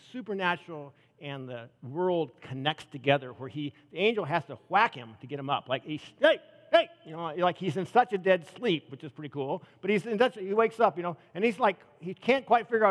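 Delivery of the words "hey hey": high, loud hey hey to rouse him; loud wake-up call. Retold or imitated. Angel rousing sleeping Peter, a high loud call. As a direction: shake him awake with your voice.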